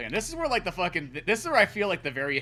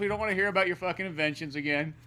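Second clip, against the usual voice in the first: usual speaking voice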